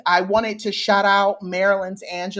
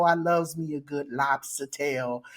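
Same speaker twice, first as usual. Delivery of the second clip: ordinary talk, nothing marked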